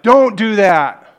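put-on loud sharp yell at the kid; dad yelling. Dad yelling at the kid who hits mom, loud. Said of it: "And dad's like".

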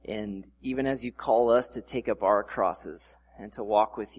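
humble prayer before the sermon; opening prayer. Acted. Pastor opens with a prayer.

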